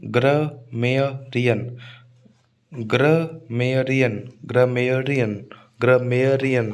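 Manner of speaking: slow ग्रमेरियन in syllables, gruh-MAIR-ian; stress on मेय. Shown as a demonstration. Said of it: "मेय पर stress आएगा". (ग्रमेरियन टुकड़ों में, मेय पर ज़ोर)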